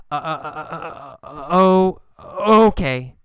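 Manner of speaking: exaggerated stop-and-start stutter before the word; hesitant stammer. Kathy isn't sure as she agrees, exaggerated hesitant stammer. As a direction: stutter on the first sound, swell, then let it out. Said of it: "She says it hesitantly. She stops and she starts".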